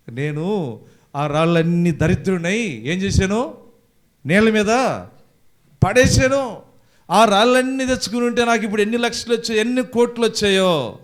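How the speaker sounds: loud, high wail of regret; acted wailing lament. Youth bewailing the stones he threw away, loud acted wail.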